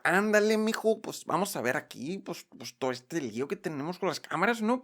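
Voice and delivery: put-on quiet, joking Mexican accent. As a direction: play a joking Mexican buddy. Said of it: "me apetece hacer acentos".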